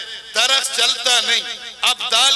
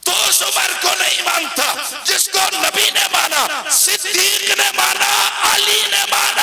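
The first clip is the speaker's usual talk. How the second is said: fiery shout; 8 semitones higher than usual. Acted. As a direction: cry out each name. Climax again.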